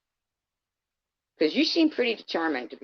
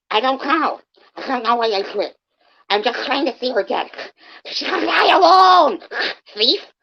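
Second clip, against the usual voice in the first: high, loud whiny mock voice of Courtney; mocking imitation; 10 semitones higher, 8 dB louder than usual. Mocking Courtney's excuse to her face, in a high, loud whiny voice. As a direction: sneer it back in a shrill put-on whine. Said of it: "your explanation would be".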